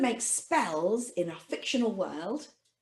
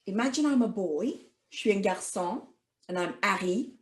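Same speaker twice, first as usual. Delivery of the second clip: normal talking voice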